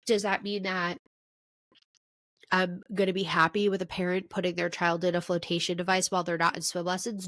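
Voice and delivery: ordinary delivery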